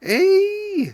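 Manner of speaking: high, loud delighted squeal. A narrator's reading. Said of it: "said Martha, delighted".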